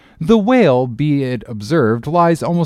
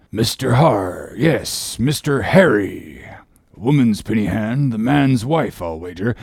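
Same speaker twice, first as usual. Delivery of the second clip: muttering. A narrator's reading. Ahab puzzling out the name on a letter, muttering. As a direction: mutter to yourself while deciphering.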